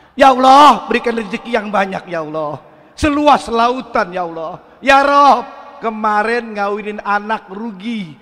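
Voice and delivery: tiruan logat Betawi, mengeluh ke Tuhan; doa Betawi yang mengeluh. Retold or imitated. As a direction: meniru orang Betawi berdoa sambil mengeluh. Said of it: "Nih doa Betawi ini dengerin". (keluhan Betawi yang dilebih-lebihkan)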